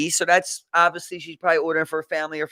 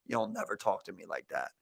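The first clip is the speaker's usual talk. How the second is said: low, hushed warning; quiet menace; 11 semitones lower, 10 dB quieter than usual. Retold or imitated. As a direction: imitate a big man's deadly quiet threat. Ex-prisoner's warning, retold.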